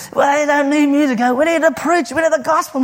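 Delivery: high-pitched imitated Macedonian accent. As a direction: imitate an insistent old Macedonian lady's accent. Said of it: "doing it in this kind of Macedonian accent".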